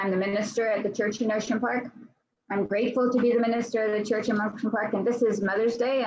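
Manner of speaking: ordinary delivery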